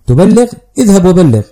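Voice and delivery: daring him with mounting anger; incredulous echo, then a sharp dismissal; angry retort. A narrator's reading. Angry retort before throwing him out.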